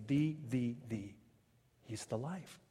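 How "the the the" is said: "the" said as a full "thee", repeated separately; emphatic THE. Shown as a demonstration. Preacher correcting "a" to the true article, emphatic "the". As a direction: softly set out each "the" as a distinct word. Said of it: "it is emphatic".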